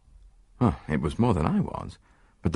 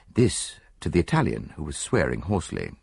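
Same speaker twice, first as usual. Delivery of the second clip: ordinary delivery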